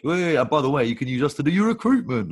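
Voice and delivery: mock sales plug. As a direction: parody a chirpy opportunist slipping in a plug. Mocks a pushy sales plug.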